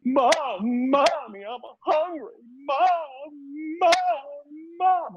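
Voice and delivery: very high mock child wail; whining kid. Hungry kid next door calling mom, mock whining. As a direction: imitate a hungry kid nagging at full pitch. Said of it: "the kid's just like".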